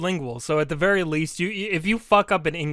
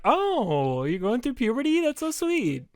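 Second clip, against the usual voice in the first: high, sing-song patronizing coo; mock cooing; 9 semitones higher, slower than usual. Whole room reacting to a voice crack, mock-sweet coo. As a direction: coo higher and higher, as if to a little kid.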